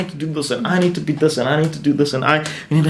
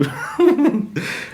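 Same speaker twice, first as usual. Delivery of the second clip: a short, high burst of laughter; real laugh; 12 semitones higher than usual. Real, not acted. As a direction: laugh at yourself, caught off guard. Laughs at his own prayer.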